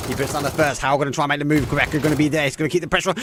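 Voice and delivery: rising, loud play-by-play call; esports caster. Real, not acted. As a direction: build the hype on every kill. Live play-by-play.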